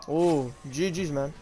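normal talking voice

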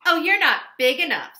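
loud high scoff; loud scoffing ladybug; dismissive. Acted. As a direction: brush them off.

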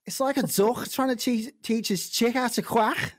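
put-on Brummie Scouse hybrid accent; a Scouse accent drifting into Brummie. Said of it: "It's like some Brummie Scouse hybrid".

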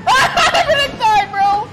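high panicked yell; panicked shout. Real, not acted. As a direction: scream it in real panic. Panicked in a fight.

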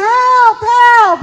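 high, loud cry for help; screaming for help. Acted. Official chased in a pasture, screaming for help. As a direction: yell out in mock panic.

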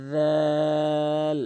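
রাল held very long, three alif lengths; রাল drawn out like Quran recitation; long drawn-out letter name. Shown as a demonstration. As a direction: stretch রাল slowly, chanting. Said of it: "তিন আলিফ টেনে রাল".

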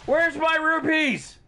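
high, drawn-out squawked demand; mock demand. Mock demand shouted during game.

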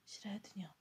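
faint, breathy, barely voiced; a sick child with almost no strength left; weak whisper. A narrator's reading. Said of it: "powiedział słabym głosem".

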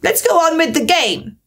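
high, bossy queen. A narrator's reading. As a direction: order it like a tyrant queen. Queen voiced.

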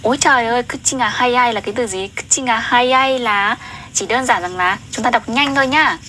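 ordinary talk

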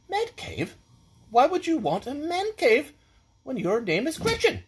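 high-pitched, baffled lordly question; puzzled. Acted. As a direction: question her in mock bewilderment, pitch leaping up. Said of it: "I looked puzzled at Gretchen".

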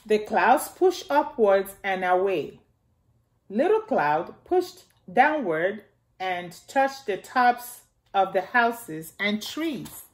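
gentle storybook reading for little ones; reading to children. Acted. Miss Nana reading Little Cloud aloud to the children, storybook voice. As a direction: read it out like story time for kids. Reads picture book to children.

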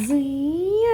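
slow, stretched imitation of a zooming car; car zoom noise. Shown as a demonstration. Mother soothing baby with toy car, long zoom noise. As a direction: draw out the zoom playfully. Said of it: "ಅಂತ ಹೋಗುತ್ತೆ".

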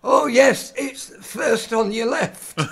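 put-on hard-of-hearing old man calling out, high and loud; loud, high northern old codger, cheerfully mishearing; Alfie. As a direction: belt it out slowly as a deaf old man. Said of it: "my character is hard of hearing".

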